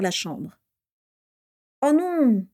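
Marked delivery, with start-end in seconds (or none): 1.8-2.5 put-on high, dismayed groan of a boy; a higher, dismayed oh no.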